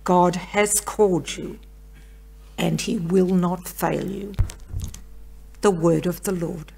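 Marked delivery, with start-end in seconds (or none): none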